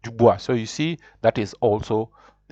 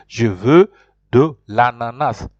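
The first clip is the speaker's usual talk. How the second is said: slow, firm French, "Je veux" louder and set apart; correct model phrase; 3 semitones lower, 3 dB louder, slower than usual. Shown as a demonstration. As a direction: spell out the correct phrase slowly. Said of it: "You have to say".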